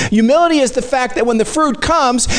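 usual speaking voice

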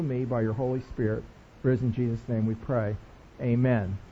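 soft, hushed prayer before the sermon. Acted. Pastor praying softly over the congregation. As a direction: pray it quietly and earnestly.

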